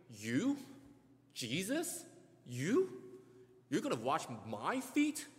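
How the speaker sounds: disbelieving rising questions; shocked, can't believe it; incredulous. Retold or imitated. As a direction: Peter stunned his teacher would wash his feet. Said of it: "It's like Peter may be asking".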